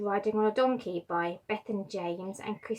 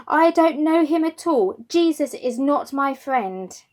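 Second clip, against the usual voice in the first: higher, louder, then slowing protest; insistent denial; 5 semitones higher, 10 dB louder than usual. Acted. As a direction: insist you never knew him. Peter denies knowing Jesus.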